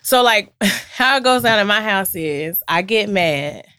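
ordinary talk

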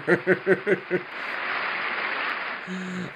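long, high-pitched laugh; real giggling. Real, not acted. Viewer amused by a politician's smile, high giggle.

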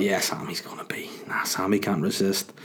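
whispered thought. Retold or imitated. Private thought about Sammy's beers.

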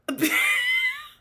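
a very high, shrieking burst of laughter; a high, squealing shriek of a laugh. Real, not acted. Shocked laugh at the cover.

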